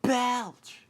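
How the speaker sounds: high, loud yell for a friend; mock screaming. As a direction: imitate a panicked scream, rising on the repeat. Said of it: "Henry starts screaming out".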